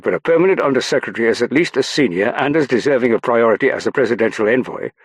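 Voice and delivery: high, loud, emphatic argument; insistent. A narrator's reading. Young man arguing over his boss's cabin, insisting loudly. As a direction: press your boss's claim insistently. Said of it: "one insisted".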